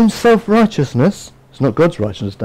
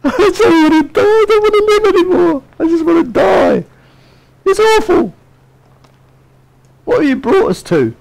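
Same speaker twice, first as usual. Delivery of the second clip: much higher, whiny mock complaint; whining Israelites; 13 semitones higher than usual. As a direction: whine like a sulky child. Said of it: "same as the people in the desert".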